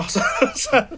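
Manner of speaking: usual speaking voice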